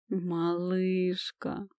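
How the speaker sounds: тихо, очень медленно, протяжно урча; протяни слово «Малышка» низким урчанием; урча со стоном. Acted. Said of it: "урча простонал". (эльф нежно отвечает любимой, тихо урча)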